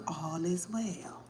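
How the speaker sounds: soft slow whisper. Real, not acted. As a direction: breathe it reassuringly. Soothing reassurance, whispered.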